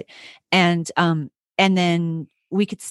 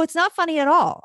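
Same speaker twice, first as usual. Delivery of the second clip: higher-pitched retold objection; indignant protest; 9 semitones higher than usual. Teacher answering mom on the phone, indignant. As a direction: insist it's no joke, voice raised in pitch.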